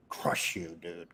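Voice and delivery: low, near-whispered threat; menacing, very soft and low; hushed threat. Real, not acted. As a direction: drop the voice right down and threaten. Threat to a rival.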